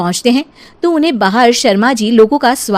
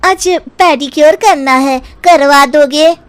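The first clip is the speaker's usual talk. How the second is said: whiny bride; 5 semitones higher, slower than usual. Retold or imitated. Son quotes Dimple's whiny request.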